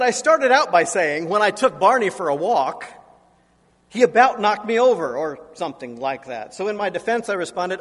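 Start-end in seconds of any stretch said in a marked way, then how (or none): 0.0-5.2 hint of an annoyed teen spelling it out; lightly voiced daughter. Daughter repeating her story to her father, lightly voiced and louder. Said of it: "She said".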